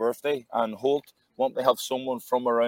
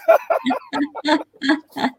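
big real laugh; high laugh; 14 semitones higher, 4 dB louder than usual. Real, not acted. Laughing at story.

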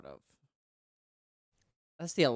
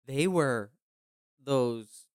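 usual speaking voice

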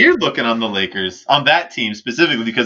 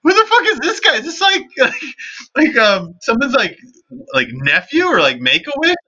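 high-pitched laughing bewilderment; laughing disbelief; 12 semitones higher than usual. Retold or imitated. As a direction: ask it cracking up in disbelief. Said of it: "I'm like".

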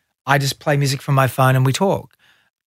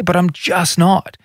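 ordinary delivery

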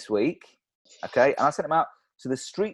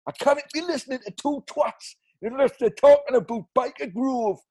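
high Geordie voice; 9 semitones higher than usual. Acted. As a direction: complain about Baker Grove, higher. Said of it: "Let's try out the next one".

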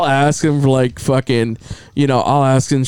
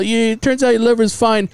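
put-on teenage clinic caller; 7 semitones higher than usual. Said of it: "teenager calls me back".

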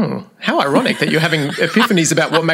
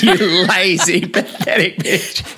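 laughing mock insult; much slower than usual. Mock abusive self-coach.

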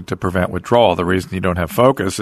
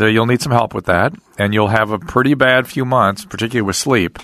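ordinary talk

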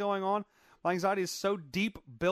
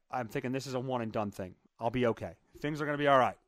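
ordinary talk, nothing marked

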